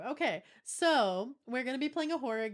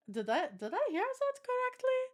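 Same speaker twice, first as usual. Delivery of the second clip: mock disbelief. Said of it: "I was just like".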